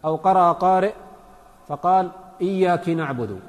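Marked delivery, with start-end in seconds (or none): 2.4-3.4 "إياك" ending in i, iyyaki; feminine-address error. Shown as a demonstration. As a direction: misread "إياك" with kasra on the kaf. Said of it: "لأنه صار الخطاب للمؤنث".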